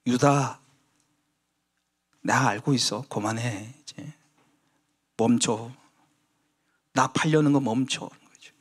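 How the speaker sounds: gentle warning. Acted. Jesus gently warning Judas.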